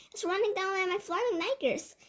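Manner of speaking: high-pitched frantic pleading; screaming. A narrator's reading. Cook begs others to free him, screaming. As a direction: cry out high for someone to help. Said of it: "the man was screaming".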